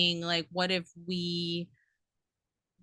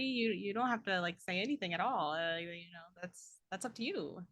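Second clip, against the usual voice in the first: trailing off; 9 dB quieter than usual. Acted. Reassures, voice fading away.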